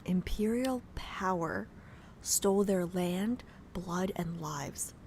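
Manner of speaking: slow, soft whispered echo; slow hushed whisper, half to herself; awed whisper. Acted. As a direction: breathe the words back, spellbound. Echoing the game line, awed.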